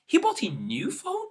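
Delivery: questioning tone; confused questioning. Shown as a demonstration. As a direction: ask it in disbelief. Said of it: "in a confused or questioning tone".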